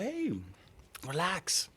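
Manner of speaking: high, mocking soothing tone; mock placating. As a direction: humor the tough guy, talk him down sarcastically. Mocking tough guys, placating.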